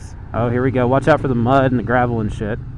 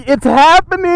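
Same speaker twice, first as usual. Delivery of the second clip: excited yell; 5 dB louder than usual. Retold or imitated. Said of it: "I was like".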